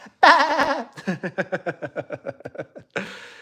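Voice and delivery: high stuttering cartoon cackle; hammed-up high-pitched chattering laugh. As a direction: rattle off a long silly cartoon giggle. Cartoon laugh after SpongeBob impression.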